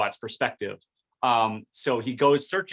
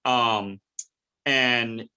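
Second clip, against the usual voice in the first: ordinary talk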